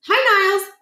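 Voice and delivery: bright sing-song hello; Holly's chirpy greeting. Acted. Holly cheerfully greeting Niles in a high voice.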